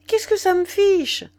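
high, loud, indignant retort; defiant dismissal. A narrator's reading. Nana brushing off Mignon's warning, loud defiance. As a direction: throw it back loudly, not caring.

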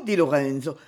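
ordinary delivery

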